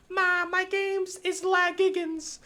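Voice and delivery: high, slow mocking whine of a kid; whiny kid voice. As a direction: whine it like a bratty child. Mocking child whining to mom.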